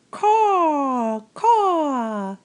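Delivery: high, loud crow caws; screaming crow. A narrator's reading. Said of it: "screamed a dark message".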